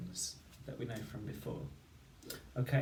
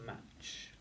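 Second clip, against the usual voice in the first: «Match» whispered, much softer; whispered repeat; 12 dB quieter than usual. Shown as a demonstration. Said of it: "the word is".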